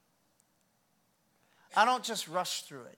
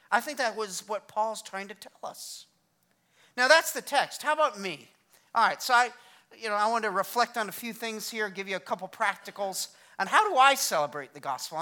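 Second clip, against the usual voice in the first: ordinary talk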